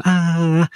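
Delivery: "ah" sung out high with a wide open mouth; wide "ah" vowel. Shown as a demonstration. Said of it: "Your mouth gets really wide".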